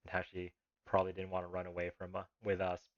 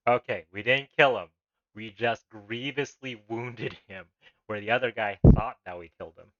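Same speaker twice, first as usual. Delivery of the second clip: loud amused disbelief; 3 semitones higher, 15 dB louder than usual. Real, not acted. Laughing realisation about the game.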